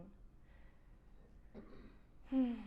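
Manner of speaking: slow audible breath out; long relaxing sigh; long sighing exhale. Shown as a demonstration. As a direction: sigh out as the shoulders drop.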